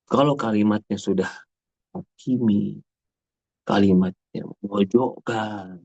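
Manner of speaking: usual speaking voice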